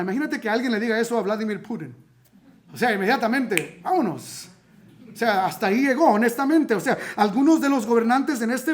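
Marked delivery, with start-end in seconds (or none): none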